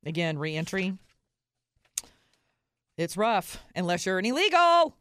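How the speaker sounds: normal talking voice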